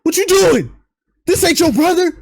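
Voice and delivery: high, pressing plea to his brother; high pleading Jimmy. Retold or imitated. Host voices Jimmy calling Jay back, high and urgent. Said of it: "Jimmy's like".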